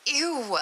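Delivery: high disgusted squeal. Acted. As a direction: recoil in disgust. Disgust at smelly substance.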